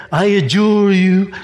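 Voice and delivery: slow, drawn-out pious formula; mock religious. What Jesus didn't say to the devil, mock religious. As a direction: stretch it out with put-on solemnity. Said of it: "he didn't do the religion".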